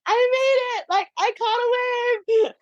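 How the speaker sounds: high, slowed, half-shouted joy; retold elation. Surfer reliving claiming the wave, high thrilled shout. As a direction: burst out in thrilled disbelief.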